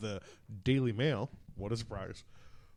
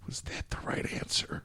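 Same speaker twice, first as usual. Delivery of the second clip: whispered sheepish question; 7 dB quieter than usual. Real, not acted. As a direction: breathe it out timidly. Timid check after the impression.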